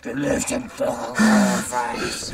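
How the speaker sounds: demon voice. Acted. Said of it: "my demon does".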